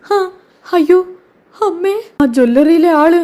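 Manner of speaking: shocked fright. Acted. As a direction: gasp in dread at being caught. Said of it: "ആകെ ഞെട്ടിത്തരിക്കുന്നുണ്ട്".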